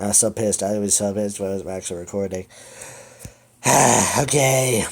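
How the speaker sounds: normal talking voice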